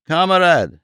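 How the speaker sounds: a mild mock yell of Kamerad!; a repeated desperate Kamerad!; mock scream. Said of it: "in a piercing voice, screamed".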